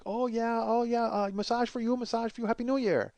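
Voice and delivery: mock sing-song sales patter; parlor woman's pitch. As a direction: coax like an eager masseuse, in imitation.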